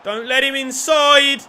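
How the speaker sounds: high, loud, alarmed warning; urgent shout. Real, not acted. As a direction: yell the warning at the defender. Same plea shouted as danger grows.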